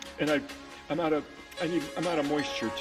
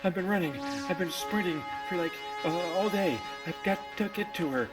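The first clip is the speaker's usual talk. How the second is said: panting, urgent gasps between phrases; breathless and desperate; out of breath; 2 semitones higher than usual. Acted. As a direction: puff it out as if you've been running. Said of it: "You're really breathing hard".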